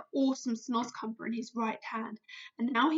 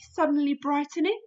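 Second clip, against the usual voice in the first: plain reading voice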